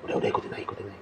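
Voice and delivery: tonlos geflüstert, drängend; whisper. Retold or imitated. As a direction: hastig zuflüstern, nicht auffallen. Pian urges him during ritual.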